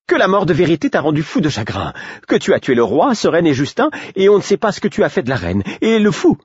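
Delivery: aiguë et pressée, en vrac; précipitée. A narrator's reading. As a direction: tout déballer d'un coup. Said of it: "Dans sa hâte de tout me raconter". (Patience pressée de tout raconter)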